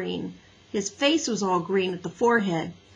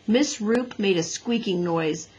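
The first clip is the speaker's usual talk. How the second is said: ordinary delivery, nothing marked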